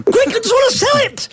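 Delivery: high, frantic caller voice; a seller squeaking in panic to sell; panicked caller. Pretend.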